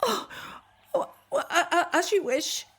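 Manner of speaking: high, broken stammer; stammering distress. Acted. Mother yields her son to the soldiers, stammering in distress. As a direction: barely get the words out.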